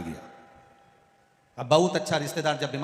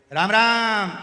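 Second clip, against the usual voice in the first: "राम राम" shouted high and loud, long falling "राम"; shouted greeting; 6 semitones higher, 9 dB louder, much slower than usual. Retold or imitated. Deaf grandfather shouts "राम राम" at the sick patient in loud greeting. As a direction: bellow "राम राम" across the ward. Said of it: "वो चिल्ला के बोलता है".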